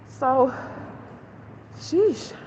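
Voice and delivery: out of breath, gasping between words; panting after walking. Real, not acted. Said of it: "I am out of breath".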